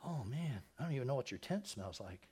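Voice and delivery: ordinary talk, nothing marked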